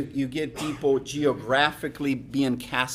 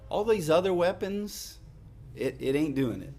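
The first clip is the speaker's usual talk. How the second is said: ordinary talk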